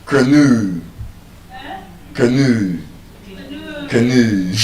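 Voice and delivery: canoe as kuh-NOO with a long falling oo; weak first vowel, stretch the end of canoe; correct pronunciation. Shown as a demonstration. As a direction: canoe stressed on a drawn-out NOO. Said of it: "the correct pronunciation of that word is".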